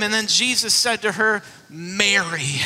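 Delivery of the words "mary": low, gentle calling of her name; tender. Retold or imitated. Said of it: "he speaks her name".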